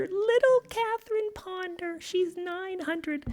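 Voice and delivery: put-on very high, slow, tiny voice for a little old lady; lightly voiced dear old lady; fond old lady voice. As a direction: coo it like a sweet little grandma. Affectionate teasing about old author.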